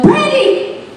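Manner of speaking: high, slow, laughing exasperation; exasperated friend. Retold or imitated. Friend holding the cleaner bottle, exasperated. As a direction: scold her through laughter. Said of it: "they're like".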